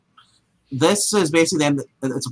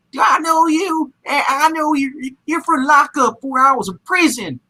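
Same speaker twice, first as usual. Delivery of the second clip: much higher, slow mocking Southern drawl; fake Southern accent; 12 semitones higher, slower than usual. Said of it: "can you get any more fake Southern with that accent".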